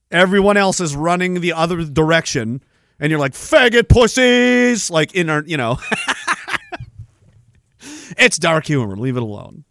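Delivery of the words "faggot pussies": mock macho shout. Imagined firefighter insult.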